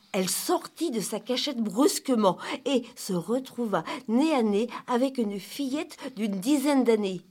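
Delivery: ordinary delivery, nothing marked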